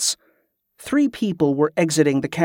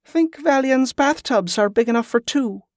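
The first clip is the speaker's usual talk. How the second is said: high, teasing question; playful flirting; 9 semitones higher than usual. A narrator's reading. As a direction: tease a companion with a coy invitation. Playful question to her companion.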